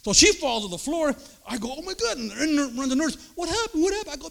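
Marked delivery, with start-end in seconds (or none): 1.8-2.2 high startled exclamation; mock shock. Wife knocked down. 3.3-4.1 high, alarmed repeated question; hurried nurse. Retold or imitated. Nurse running in.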